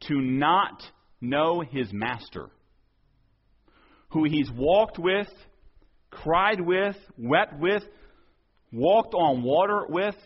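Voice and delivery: each phrase slow and rising; preacher building slowly; slow building emphasis. Real, not acted. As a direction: lean on every 'with'.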